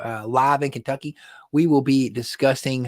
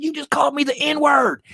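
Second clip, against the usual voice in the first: high, loud indignant cry; retold outraged disbelief, shouted high; incredulous protest; 5 dB louder than usual.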